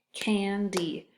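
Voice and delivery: candy split slowly into can and D; slow syllable demo. Shown as a demonstration. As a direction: stretch each syllable out slowly. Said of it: "has two syllables".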